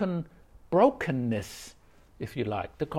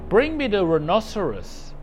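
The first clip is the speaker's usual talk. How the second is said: higher, louder mock command; 6 semitones higher, 6 dB louder than usual. Master demanding the rhinoceros, commanding. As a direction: demand it outright.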